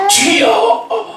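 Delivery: high, drawn-out shout to God; all-out prayer cry. Shown as a demonstration. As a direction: cry out with all your strength. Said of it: "큰 소리로 있는 힘을 다해서".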